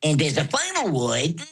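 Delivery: high Bugs Bunny voice. Shown as a demonstration. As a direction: play the cheeky wabbit.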